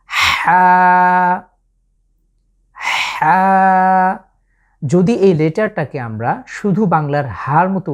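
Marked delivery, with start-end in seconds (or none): 0.1-4.2 হা pressed from mid throat, louder; throaty Arabic ha. Shown as a demonstration. Teacher shows viewers the throaty letter ha. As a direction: squeeze হা out of the throat. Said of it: "আবারও খেয়াল করুন".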